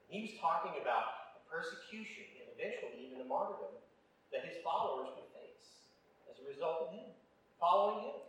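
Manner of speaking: ordinary talk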